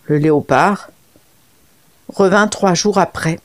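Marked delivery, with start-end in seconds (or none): none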